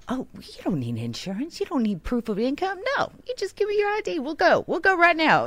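very high, fast, cartoonish dealer; mock car dealer. Sister sending up her brother's expectations of dealers, high and fast. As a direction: ham it up as a careless salesman. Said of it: "They'd be like".

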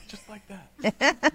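lightly voiced, slow and laughing; a hint of Meredith, drawn out. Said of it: "That's my Meredith voice".

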